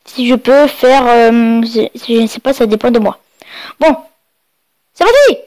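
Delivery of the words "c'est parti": excited, high-pitched launch cry; high-pitched "here we go" energy; excited kickoff. Real, not acted. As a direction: kick it off with a high, rising-then-falling pop. Kicks off the game video.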